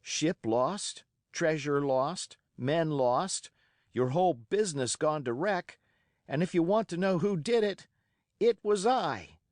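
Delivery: put-on a boy's bold, racing challenge to pirates; excited defiance. A narrator's reading. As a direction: fling it at them all, fired up and fearless. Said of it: "by this time I was quite excited".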